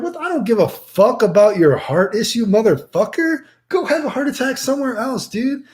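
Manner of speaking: high, heated mock yell at Char; retold angry rant.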